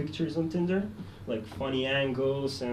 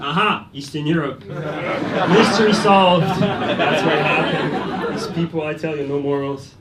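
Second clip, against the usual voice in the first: mock smug verdict; 2 semitones higher, 9 dB louder than usual. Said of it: "bank guy's verdict, mock".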